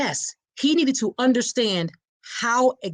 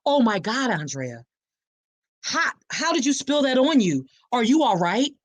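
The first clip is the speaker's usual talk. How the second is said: mock alarmed husband, pitched up; feigned concern; 4 semitones higher than usual. Husband pretending she spilled coffee on herself, feigned concern. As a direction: fake the worry for onlookers. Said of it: "guess what he said".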